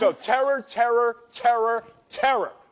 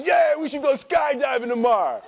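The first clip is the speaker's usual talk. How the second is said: cheerful, somewhat higher offer; tipsy suggestion; 6 semitones higher, 3 dB louder than usual. Retold or imitated. Friend at the bar suggests skydiving, lightly excited. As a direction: toss the idea out over drinks. Friend's proposal before the shout.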